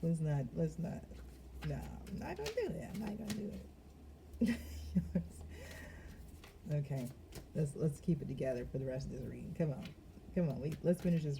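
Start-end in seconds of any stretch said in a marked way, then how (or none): 4.6-6.7 airy stifled laughter; breathy laugh. Real, not acted. Fighting giggles during the reading, breathy laugh.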